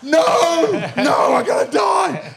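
very high, loud cry of doom; very high, loud laughing yell; panicked shout. Retold or imitated. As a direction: yell in mock terror. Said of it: "I go".

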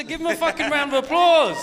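loud, high cheering call; shouting. Retold or imitated. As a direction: bellow it like a pub geezer. Imitating crowd geezer shouting.

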